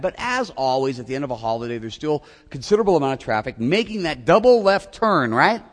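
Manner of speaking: normal talking voice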